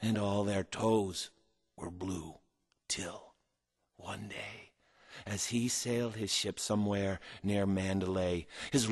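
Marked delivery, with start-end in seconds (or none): 1.8-4.7 storyteller whisper. A narrator's reading. Hushed rhyme for a listening child.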